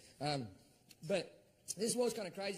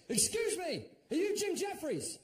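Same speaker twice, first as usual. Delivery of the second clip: eager soldier; 9 semitones higher, 5 dB louder than usual. Retold or imitated. Soldier voiced in a story.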